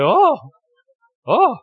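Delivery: high-pitched startled "oh"; mock dismay at being found out; acted alarm. Someone hit by being found out.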